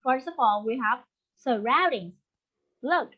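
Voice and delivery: ordinary talk, nothing marked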